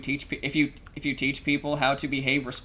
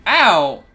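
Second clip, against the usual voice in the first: loud sudden ow of pain; pained yelp; 5 semitones higher, 12 dB louder than usual. Real, not acted. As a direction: yelp as if just hit. Bonked by a barrel.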